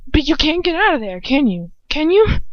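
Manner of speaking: worried, insistent question; anxious. A narrator's reading. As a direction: press nervously for an answer. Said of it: "I try anxiously".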